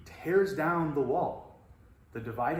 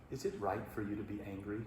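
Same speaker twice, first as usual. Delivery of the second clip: soft, quick, gently patient question; patient parent; 6 semitones lower, 11 dB quieter, faster than usual. Retold or imitated. God gently asking angry Jonah, patient and soft. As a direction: ask it like a calm parent to a ranting child. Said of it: "the very patient parent says".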